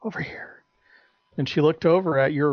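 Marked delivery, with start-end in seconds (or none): 0.0-0.6 hushed, beckoning whisper; imitated furtive whisper. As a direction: whisper it like a secret come-on. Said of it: "somebody going".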